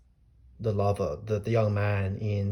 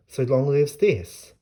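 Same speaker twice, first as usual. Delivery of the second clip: slow, higher, louder on lives; slow stress on lives; emphatic quote; 4 semitones higher, 5 dB louder, slower than usual. Shown as a demonstration. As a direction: lean on lives, slowly. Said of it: "re-quotes line with emphasis".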